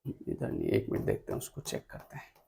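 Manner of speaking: very soft, half-voiced aside; muttering to self. Real, not acted. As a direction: think aloud under your breath while checking. Talking to himself while checking notes.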